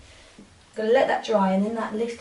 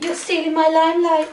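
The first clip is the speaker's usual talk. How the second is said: playful higher, louder Liverpudlian impression of a friend; Liverpudlian accent; 8 semitones higher, 8 dB louder than usual. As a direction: mimic a Scouse girl teasing, loud and high. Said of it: "Liverpudlian accent".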